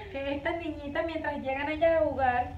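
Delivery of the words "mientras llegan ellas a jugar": usual speaking voice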